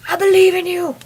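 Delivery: high, loud rallying cry; cheerleading encouragement. Real, not acted. Encouraging a friend through senior year, loud peppy cheer.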